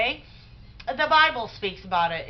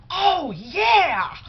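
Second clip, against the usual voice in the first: high, loud, thrilled "oh yeah"; delighted gush; 9 semitones higher, 7 dB louder, much slower than usual. Retold or imitated. Young woman gushing to the speaker about the show, delighted and loud. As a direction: gush like it's the most wonderful news. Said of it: "like it was this wonderful thing".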